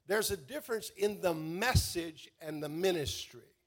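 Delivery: ordinary talk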